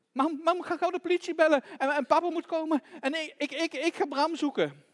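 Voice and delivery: put-on high, loud frantic child; acted urgency. Child urges police call after theft.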